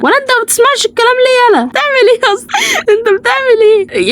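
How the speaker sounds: half-laughing high scold. Real, not acted.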